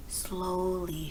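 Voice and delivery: ordinary delivery, nothing marked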